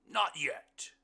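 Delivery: loud, high character voice; hearty blind man. A narrator's reading. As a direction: answer big and high, with gusto. Blind man's line in story.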